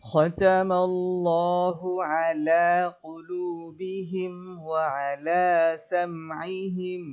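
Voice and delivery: slow, high, drawn-out tajweed chant; Quran recitation. Shown as a demonstration. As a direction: sing the verse slowly with long held vowels. Recitation resumes.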